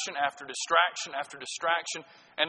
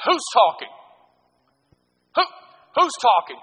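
a hint of a stern teacher, louder and higher; stern teacher call; 7 semitones higher, 8 dB louder than usual. Teacher demanding who is talking in class, mildly stern. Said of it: "The teacher would say".

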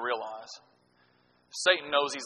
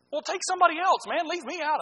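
put-on indignant kid; 12 semitones higher than usual. Kid protesting to classmate.

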